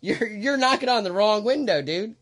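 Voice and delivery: high, loud, amused complaint; retold exasperated protest. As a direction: act out the driver setting him straight. Retold driver correcting the protester, amused.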